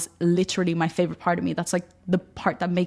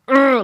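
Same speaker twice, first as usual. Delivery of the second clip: fiery grunt; 7 semitones higher, 9 dB louder than usual. Shown as a demonstration. Illustrating fiery feminine energy.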